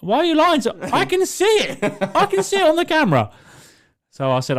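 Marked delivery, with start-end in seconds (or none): 0.0-3.3 retold shouting. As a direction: shout down from upstairs at the lying kid. Yelling at son from upstairs.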